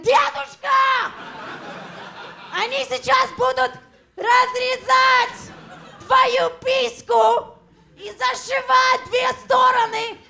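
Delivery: frantic shouted Russian to grandpa; shouting to grandpa. Retold or imitated. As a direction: yell it across the room in Russian.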